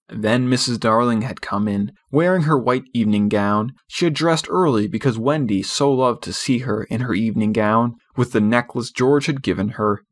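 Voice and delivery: usual speaking voice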